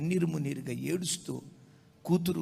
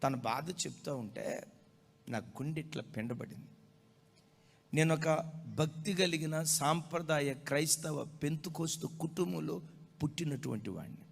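ordinary delivery

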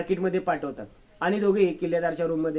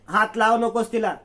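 sharp, raised warning to keep her hands off; angry command; 5 semitones higher, 5 dB louder than usual. Acted. Grandmother to Sayli, keeping her from Kalpana: angry raised command. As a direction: snap at her to stay away, louder.

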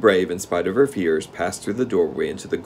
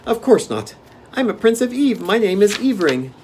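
put-on high, loud child's announcement; proud little boy; 14 semitones higher, 5 dB louder than usual. A narrator's reading. Young prince introduces himself to Dorothy, high and proud. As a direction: declare it high with childish pride. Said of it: "the little one announced proudly".

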